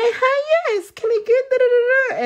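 very high-pitched mocking customer voice. Customer ordering at the drive-thru, high mocking imitation. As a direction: squeak out an order, trailing into nonsense syllables. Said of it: "And then they'd be like".

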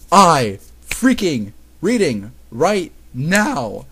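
slow, loud, word by word; loud, drawn-out mock outrage; exasperated outburst. Real, not acted. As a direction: punch out each word in disbelief. Reader reacts aghast to the story.

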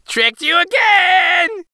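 high, slow, shouted taunt breaking into long laughter; gleeful high-voiced prankster; laughing, gloating boy. A narrator's reading. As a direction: crow over the trick, then cackle. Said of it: "the boy laughed".

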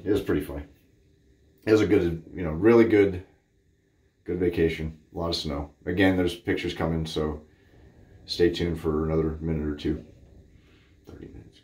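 normal talking voice

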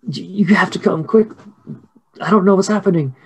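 high, urgent, stammered plea; panicked mother. Acted. Mary hurries the girls inside, high panicked voice. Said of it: "and she's like".

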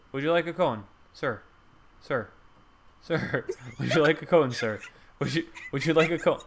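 lightly voiced, pestering cone seller; pleading vendor. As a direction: call after a customer, half laughing. Playing a cone seller hawking.

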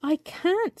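small, high-pitched timid reply; timid little creature voice. A narrator's reading. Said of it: "said Birdling timidly".

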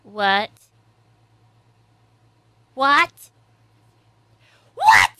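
disbelief climbing with each repeat; building disbelief. Real, not acted. Shocked by a plot twist.

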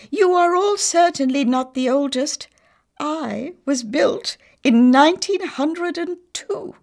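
high, loud correcting tone; strict old professor. A narrator's reading. Professor correcting the houses, high and stern. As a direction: set them all straight.

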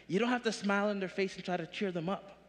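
ordinary delivery

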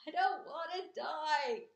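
slow, high, loud wail; screamed. A narrator's reading. Pig throwing himself to the ground, screamed. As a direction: wail it in despair, drawn out. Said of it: "screamed Wilbur".

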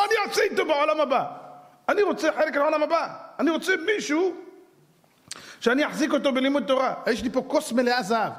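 high-pitched, insistent declaration; acted eager patron, pitched well up; eager rich man. Acting rich man's eager offer.

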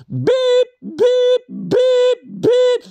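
bleep it out like a censor tone; censor beep. Pretend. Bleeping out swear words in mock outrage.

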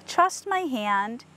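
ordinary delivery

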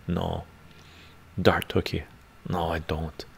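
ordinary talk

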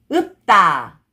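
읍다 joined as 읍따, tense tt; read together. Shown as a demonstration. Teacher shows how it reads together, linked pronunciation. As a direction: run 읍다 into one word with a hard 따. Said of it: "Just pronounce".

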